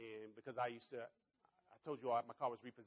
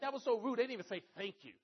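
raised, indignant retold gripe; complaining; 8 semitones higher, 6 dB louder than usual. Husband to wife after holding the door, complaining. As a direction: grumble in high, loud disbelief. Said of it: "I'm complaining to Tracy".

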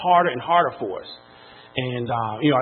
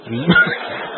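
a short real laugh that rises at the end. Real, not acted. Laughing at the memory of the car being repossessed. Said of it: "Well, I'm laughing now".

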